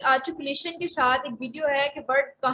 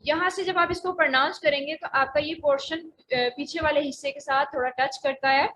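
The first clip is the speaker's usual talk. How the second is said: usual speaking voice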